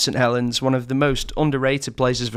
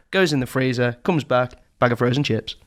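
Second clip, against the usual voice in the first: normal talking voice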